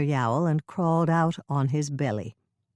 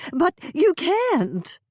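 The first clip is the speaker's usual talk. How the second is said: slow, high, louder plea; a hammed-up frantic mother; alarmed protest; 14 semitones higher, 5 dB louder, much slower than usual. A narrator's reading. Dolores trying to block Hannah, frantic.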